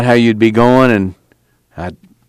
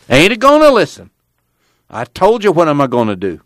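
put-on defiant old-timer, pitched up; stubborn Grandpa; 7 semitones higher than usual. A narrator's reading. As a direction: shut him down as a cantankerous grandpa.

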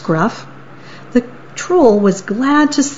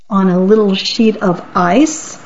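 ordinary delivery, nothing marked

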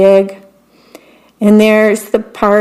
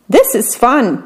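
ordinary delivery

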